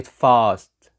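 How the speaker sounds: "fast" drawn out as "faahst"; "fast" stretched on the vowel of "father"; British fast. Shown as a demonstration. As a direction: model "fast" with a long back "ah". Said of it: "والمشهور عن البريتش".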